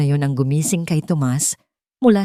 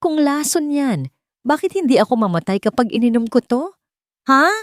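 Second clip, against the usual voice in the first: defiant challenge; 7 semitones higher than usual. A narrator's reading. Rowena defiant before drinking.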